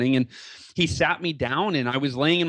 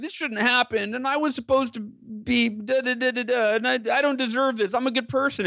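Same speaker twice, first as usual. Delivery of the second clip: higher, whiny self-pity voice; mock victim whining; 9 semitones higher than usual. Speaker mocking his imagined self in a wheelchair, whiny. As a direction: mimic a sulking victim. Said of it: "this victim mentality".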